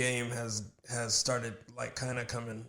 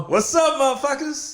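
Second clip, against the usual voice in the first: loud hype greeting; 14 semitones higher, 11 dB louder than usual. Real, not acted. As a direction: shout the greeting, high and loud. Hyped podcast greeting.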